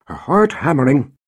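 ordinary delivery

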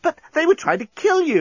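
very high, incredulous protest; exclaimed protest. A narrator's reading. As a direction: protest with pitch shot right up. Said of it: "exclaimed Nettie".

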